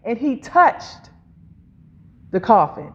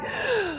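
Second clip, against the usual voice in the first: reviving gasp; 9 semitones higher, 11 dB quieter than usual. Acted. Preacher acting the young man raised to life, reviving gasp. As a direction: suck in air like someone revived. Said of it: "breath came back into his body".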